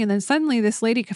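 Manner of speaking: ordinary talk, nothing marked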